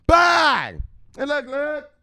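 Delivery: high, loud, shouting hype voice; hype-man rant. Acted. Hyped vlogger shouting crypto news at his mama.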